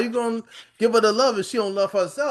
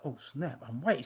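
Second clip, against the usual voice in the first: a low, much softer hushed aside; shocked realization; 8 semitones lower, 13 dB quieter than usual. Retold or imitated. Grandmother realizing her mistake, hushed and low. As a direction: mutter it to yourself as it dawns. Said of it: "It dawned on her like".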